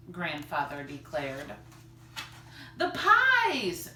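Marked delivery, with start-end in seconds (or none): 2.8-3.9 high, loud alarmed cry; suddenly remember the pies; cried. Acted. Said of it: "cried Missus Tappleton".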